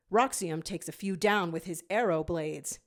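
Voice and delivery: usual speaking voice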